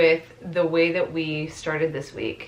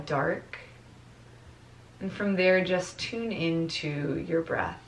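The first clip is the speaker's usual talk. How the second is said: soft meditation voice with long pauses; guided meditation; 6 dB quieter than usual. Real, not acted. Teacher guides class to notice their breath, guided meditation voice. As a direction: ease them into the breath, letting phrases fade softly.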